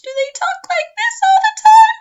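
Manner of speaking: squeaky, very high and loud voice; very high pitch. Shown as a demonstration. Teacher demos a too-high voice to students, very high. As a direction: demo an abnormally high speaking pitch. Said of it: "a lower pitch or a higher pitch".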